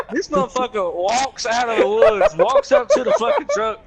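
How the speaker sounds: high, loud, cracking with laughter; can barely get it out for laughing; excited and laughing. Real, not acted. Story climax, laughing.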